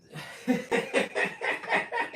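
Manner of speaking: high, loud burst of laughter; high unvoiced laugh rising; real laugh. Real, not acted. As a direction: crack up at the memory. Laughing at his own story.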